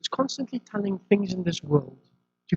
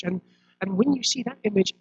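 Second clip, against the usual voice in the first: ordinary talk, nothing marked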